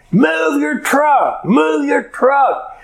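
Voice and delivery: high, drawn-out mock yell; retold yelling. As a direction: yell it slowly like the irate driver being imitated. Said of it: "She starts yelling at him".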